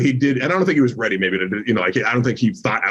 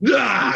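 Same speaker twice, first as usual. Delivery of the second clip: mock yelp; 10 semitones higher than usual. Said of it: "It was just like".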